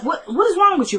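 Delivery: high, stumbling questioning start; retold alarmed concern. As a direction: imitate a friend taken aback by tears. Retold friend reacting to her crying.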